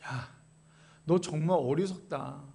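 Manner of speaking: low, hushed reproach as Job; quiet rebuke. Acted. Job answering his wife, quiet low rebuke. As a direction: drop right down and chide softly. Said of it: "뭐라고 얘기합니까?".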